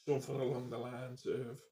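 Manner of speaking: normal talking voice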